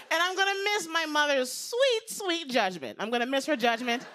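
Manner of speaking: usual speaking voice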